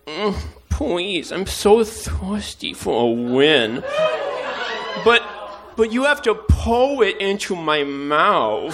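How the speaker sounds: high, slow, hammy begging; pleading thirsty voice. Pretend. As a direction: beg like a parched loser. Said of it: "I'm basically going like".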